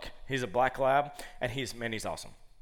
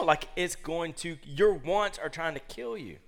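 ordinary talk, nothing marked

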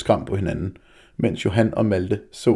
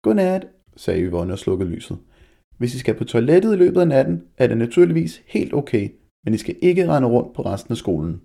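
much higher, louder lightly voiced teacher; 4 semitones higher, 5 dB louder than usual. Teacher saying goodnight to the class, higher teacher voice. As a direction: speak it as the class teacher, kind but firm.

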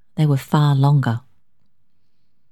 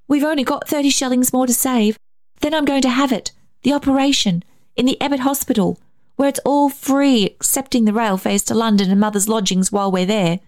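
put-on high, earnest child's voice; eager child, pitched well up; pleading young boy; 9 semitones higher than usual. A narrator's reading. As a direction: explain and beg as a little boy. Child pleads to save the hospital.

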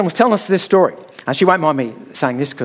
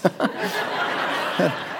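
soft airy chuckle; a quiet unvoiced laugh; breathy laugh; 8 dB quieter than usual. Real, not acted. Preacher chuckling softly after the story's punchline.

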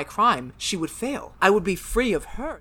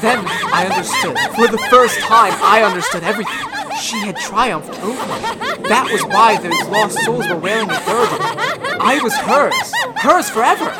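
mad laughter; 6 dB louder than usual. Acted. Daryl laughs madly.

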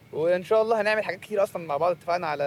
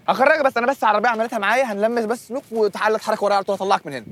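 overexcited; 6 semitones higher, 5 dB louder than usual. Acted. Overeager helper, shouting excitedly.

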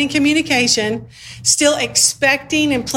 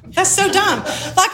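laughing; 7 semitones higher, slower than usual. Real, not acted. Laughing at own phrase.

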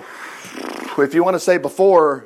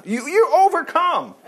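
ordinary talk, nothing marked

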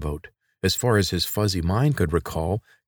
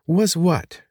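sharp, higher, louder demand; girl pressing the boy to finish; 12 semitones higher, 5 dB louder than usual. Acted. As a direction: push him for the answer. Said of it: "she demanded".

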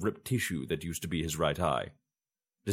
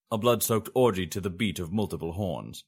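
ordinary delivery, nothing marked

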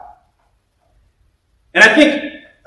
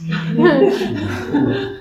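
a high, airy laugh at his own story; short high laugh; amused laugh; 12 semitones higher than usual. Real, not acted. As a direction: laugh it off lightly.

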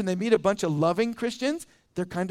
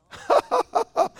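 high scoffing laugh; mocking burst of laughter; 4 dB louder than usual. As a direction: laugh off the question, mock. Implied immediate laughing answer.

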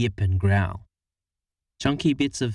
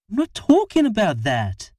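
exasperated; 12 semitones higher, 4 dB louder than usual. Acted. Harriet snaps.

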